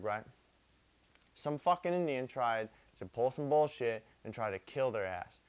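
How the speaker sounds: ordinary delivery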